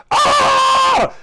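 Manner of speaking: high, loud yelp of shock; a hammed-up shriek at the wig in his hand; uncle's startled shout. Uncle staring at his own wig, high loud yelp. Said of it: "and he goes".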